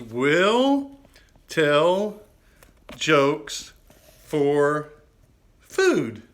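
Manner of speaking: loud, high, drawn-out sign reading; proud showman reading his own sign; reading the sign aloud. Shown as a demonstration. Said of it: "It says".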